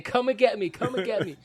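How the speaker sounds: high, loud pleading call; high shouted plea, laughing at the memory; desperate shout. Retold or imitated. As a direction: beg them to come, at the top of the voice. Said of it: "I remember I was saying".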